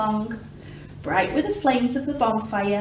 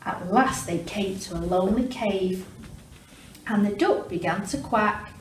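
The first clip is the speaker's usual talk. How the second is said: ordinary delivery, nothing marked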